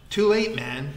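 loud, triumphant bellow. A narrator's reading. Vikram bellows while throwing his shirt, loud. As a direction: call it out with force. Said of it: "he bellowed".

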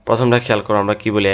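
ordinary talk

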